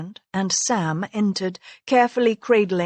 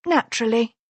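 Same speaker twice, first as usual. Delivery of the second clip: sour naturally; 3 semitones higher, faster than usual. Acted. Wife sourly concedes to her husband, grudging "Naturally". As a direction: bite out Naturally as if tasting a lime. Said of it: "as if she'd just bitten into a lime".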